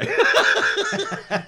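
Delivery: very high, loud cackle; loud helpless laugh; real burst of laughter. Real, not acted. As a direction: shriek with laughter at your own joke. Laughing at own impression.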